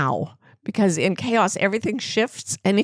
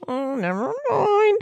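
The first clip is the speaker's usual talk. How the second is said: light, high and slow, dropping the subject; dropping a story mid-thought, high and slow; high-pitched never mind; 12 semitones higher, slower than usual. Real, not acted. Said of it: "breaking off a story".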